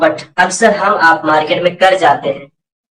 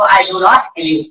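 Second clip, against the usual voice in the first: slow dictation; much slower than usual. Shown as a demonstration. Teacher dictates example sentence.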